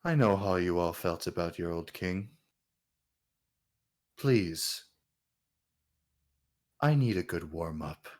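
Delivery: low, soft, unhurried challenge; composed king, low and measured; king facing attack. Acted. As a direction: stay cool and quiet under attack. Game master voices the king.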